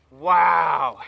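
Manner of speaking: loud burst of amazement; loud amazed wow. Real, not acted. Landing a huge fish, shouts to viewers in amazement. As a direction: let it burst out.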